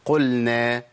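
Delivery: "قلنا" as "qul-na", l fully sounded before n; chanted "قلنا" with a distinct l; clear lam. Shown as a demonstration. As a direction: keep lam and nun apart in "قلنا". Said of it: "الشيخ بيقول".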